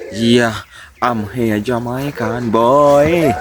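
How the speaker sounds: ordinary delivery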